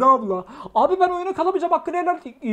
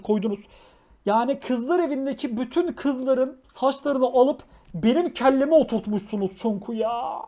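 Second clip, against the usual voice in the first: normal talking voice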